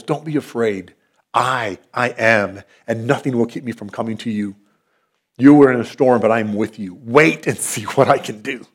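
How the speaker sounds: hushed tender voice of Jesus; reassuring God. Retold or imitated. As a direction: speak comfort to the frightened disciples. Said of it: "He says to you".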